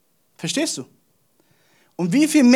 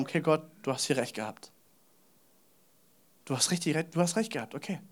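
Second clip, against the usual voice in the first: much softer, lower grudging admission; humbled concession; 5 semitones lower, 13 dB quieter, faster than usual. Acted. Science bowing to God, meek quiet concession. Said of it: "muss sich beugen und sagen".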